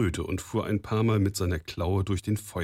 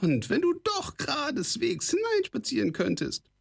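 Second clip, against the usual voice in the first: very high goblin voice full of scorn; a scornful goblin's squawk; sneering kobold rasp. A narrator's reading. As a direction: talk down to him like a mocking goblin. Kobold answers.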